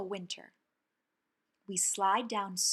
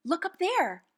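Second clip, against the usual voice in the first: high, loud, eager cry; excited call; 8 semitones higher, 7 dB louder than usual. Acted. Child calling to family about stars, excited. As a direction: point at the sky, thrilled.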